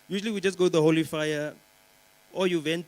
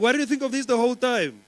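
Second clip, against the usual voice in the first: exasperated; 7 semitones higher, 5 dB louder than usual. Retold or imitated. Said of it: "And I'm like".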